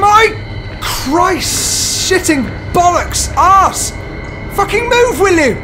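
frantic outburst of swearing; panicked swearing. Real, not acted. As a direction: yell every curse in a frenzy. Panicked swearing while fleeing.

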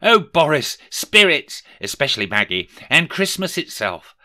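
high, dramatic thanks; grateful exclamation. A narrator's reading. Scrooge thanking the spirits for another chance, exclaiming. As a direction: exclaim it with relieved energy. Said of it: "he exclaimed".

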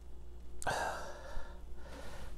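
long breathy sigh of suffering; long pained exhale. Real, not acted. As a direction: blow out the burn slowly. Pepper burn aftermath.

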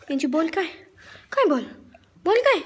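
loud, high urging; excited pet talk. Real, not acted. Girl urges her dog after the ball, loud excited pet call. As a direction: rouse the dog to chase the ball, loudly.

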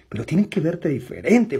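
ordinary delivery

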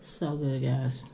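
talking with food in mouth; chewing. Real, not acted. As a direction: mouth full while speaking. Seen 3: Forkful of eggs into mouth at 1.3–1.8s, chewing afterwards.

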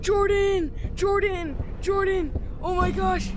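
loud frantic screams; yelling for a friend in terror; panicking gamer. Real, not acted. Caught by monster, screaming.

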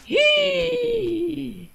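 long high "hii" falling off; shocked gasp. Pretend. Officer shocked by what turns up, long gasp. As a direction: draw out a high, horrified gasp.

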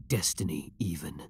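soft unvoiced whisper trailing off; whispered aside. Villain to captive beloved, whispering. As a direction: breathe the last word out as a secret. Villain muses on changing destiny.

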